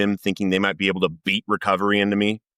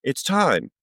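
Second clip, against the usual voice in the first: singsong fake delight, pitched way up; mock joy; 10 semitones higher, much slower than usual. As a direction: imitate an imagined happy yes to God. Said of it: "you would think I was like".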